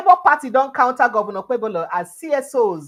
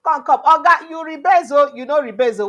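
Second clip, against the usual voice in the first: higher, louder calling out to the boss; acted-out raised-voice heckle; mock shout; 6 semitones higher, 6 dB louder than usual. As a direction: shout a protest at the big man. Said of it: "A D C don shout say".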